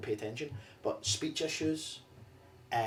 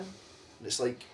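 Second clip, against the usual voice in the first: usual speaking voice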